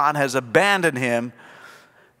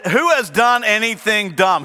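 normal talking voice

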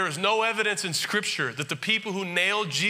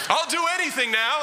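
mock groveling apology; 10 semitones higher, 4 dB louder than usual. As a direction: ham it up like a weeping Shakespeare penitent begging forgiveness. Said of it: "They're like".

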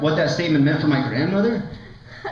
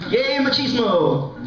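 higher, drawn-out mock cheer; sarcastic cheer; 8 semitones higher, slower than usual. Comic mocking her family's machismo, slow ironic cheer. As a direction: cheer it with heavy irony.

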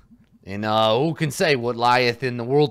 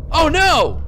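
panicked; 8 dB louder than usual. Acted. Panicked exclamation in game.

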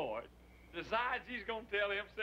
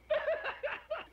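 short, very high cackle; high giggle; laughing; 3 dB louder than usual. Acted. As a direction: burst out laughing at your own joke. Laughs before his punchline.

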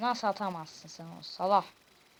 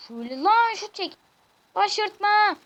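excited shout; 10 semitones higher, 12 dB louder than usual. Real, not acted. Urging the player to shoot.

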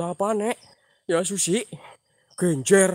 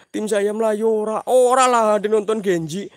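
normal talking voice

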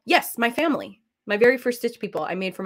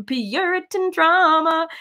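mock prim; 8 semitones higher, 4 dB louder, slower than usual. Real, not acted.

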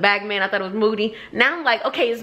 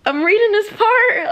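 choking up while reading, louder and higher; about to cry; 11 semitones higher, 4 dB louder than usual. Real, not acted. Reader tearing up while reading a sad scene, voice cracking. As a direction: voice high and cracking with real tears. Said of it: "I'm about to cry".

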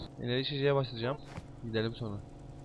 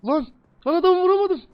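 frustrated yelp; 12 semitones higher, 7 dB louder than usual. Real, not acted. Missed shot, frustrated yelp.

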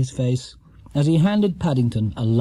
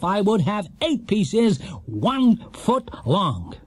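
slow, higher know-it-all host; smug quizmaster; 7 semitones higher than usual. A narrator's reading. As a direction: lecture them slowly like a gloating game show host. Quizmaster explains triumphantly.

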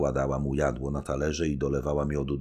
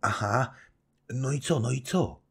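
quick, eager little prompts; eager questioning girl; 9 semitones higher than usual. A narrator's reading. Young wife prodding her husband to go on with his tale. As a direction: urge him on, hungry for the story. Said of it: "dopytując".

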